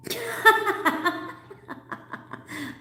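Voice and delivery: sudden high breathy cackle. Real, not acted. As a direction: let out a high, airless cackle. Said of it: "I like my cackle".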